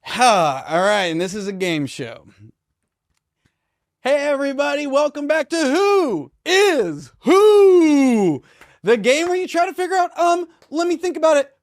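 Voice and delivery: over-the-top game show host, high and drawn out. Acted. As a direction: sell it like a cheesy TV host. Hosting a mock game show.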